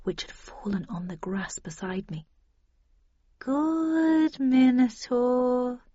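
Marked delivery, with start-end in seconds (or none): 3.4-5.8 very slow, high-pitched coaxing; soothing. A narrator's reading. Narrator placating the Minotaur while reaching for the gun, slow soothing singsong. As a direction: stretch it out as if calming a beast. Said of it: "I said soothingly".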